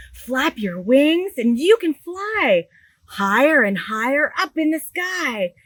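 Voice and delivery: bright, lively storybook read-aloud; animated picture-book reading; reading to children. Acted. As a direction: read it big for a room of little kids.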